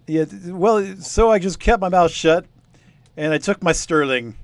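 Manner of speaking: a hint of a chatty old villager spinning a yarn; old local villager. Game master voicing old villager telling the investigators, lightly voiced.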